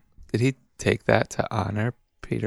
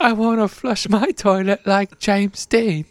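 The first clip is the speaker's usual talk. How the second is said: higher, slow mock Australian accent; 9 semitones higher than usual. Aussie wishing for American toilets, Australian accent. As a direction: ham up an Aussie wish. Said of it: "Been working on my Australian accent".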